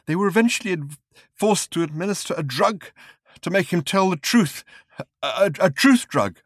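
high, strained, agitated voice; raving journalist. Acted. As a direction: babble it out half mad. Raving journalist in sick bay.

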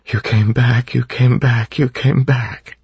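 cling to the words, near tears; overcome with emotion. A narrator's reading. Vin clings to returned Elend.

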